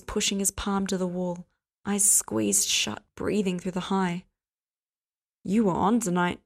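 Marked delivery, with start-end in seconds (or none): none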